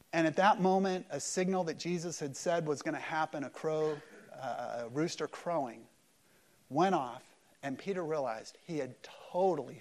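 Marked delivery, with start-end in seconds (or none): none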